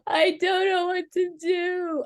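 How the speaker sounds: high, slow, hopeless plea; despairing. Retold or imitated. Lying on the bed after the fight, saying it in despair. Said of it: "I must have said that a hundred times".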